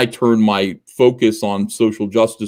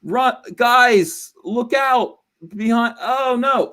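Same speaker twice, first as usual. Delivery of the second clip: high, frantic panicked cry; alarm shout; 13 semitones higher, slower than usual. Retold or imitated. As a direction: squeal a panicked warning. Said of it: "He's like".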